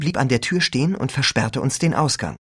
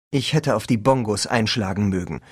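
plain reading voice

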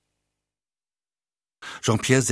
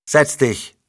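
a higher, louder stern order; 5 semitones higher, 4 dB louder than usual. Acted. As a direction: command him to sit, no argument. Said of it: "sagte Leblanc streng".

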